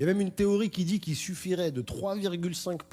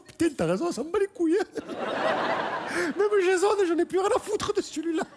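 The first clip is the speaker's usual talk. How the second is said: Mireille losing it. Acted. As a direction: shriek like a fed-up single mom at a protest. Mireille high voice again.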